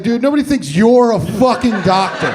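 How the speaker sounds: high, loud incredulous retort; exasperated yell. Acted. Comedian to construction worker, exasperated yell. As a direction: set him straight loudly, fed up.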